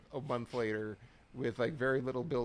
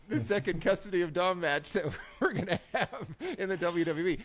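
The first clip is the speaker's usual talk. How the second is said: amused, higher and louder, breaking into laughter; laughing while talking; 7 semitones higher, 4 dB louder than usual. Real, not acted. Cohost riffing on the custody of Dom match, laughing. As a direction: say it through real laughter. Cracking up over custody joke.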